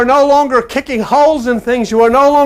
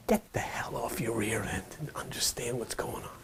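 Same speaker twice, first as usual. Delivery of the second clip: urgent whisper telling you to get up; whispered rough inner voice; whispered command; 12 semitones lower, 23 dB quieter than usual. Pretend. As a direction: hiss it low and hard. Inner voice contrasted with gentleman.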